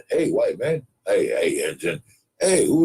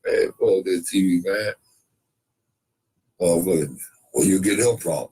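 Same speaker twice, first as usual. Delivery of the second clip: ordinary talk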